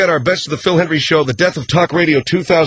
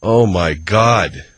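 low, slow disbelief; dismayed; 9 semitones lower, much slower than usual. Acted. Host left horrified by threat, low dismay. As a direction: let it sink in, much lower.

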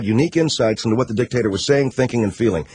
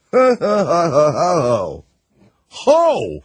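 high hoot falling away, then one more; big mocking "ho" laughs, pitched up high; 4 semitones higher, much slower than usual. Real, not acted. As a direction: crow with delight at a juicy scoop. Mocking reaction to Viagra news.

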